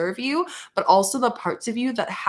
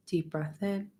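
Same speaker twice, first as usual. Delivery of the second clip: guided meditation; 3 semitones lower, 5 dB quieter than usual. Acted. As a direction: ease them gently into the breath.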